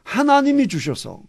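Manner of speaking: 하나님이 hit high and loud; stress on 하나님이. Acted. Preacher stressing 하나님이 to show Adam blames God.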